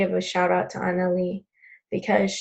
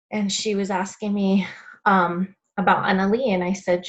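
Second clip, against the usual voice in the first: normal talking voice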